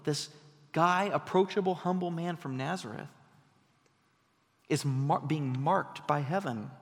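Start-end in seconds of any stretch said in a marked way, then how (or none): none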